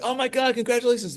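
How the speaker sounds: high, squealing congratulations; excited congratulations. Retold or imitated. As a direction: gush like a thrilled classmate. Classmates congratulating him on winning.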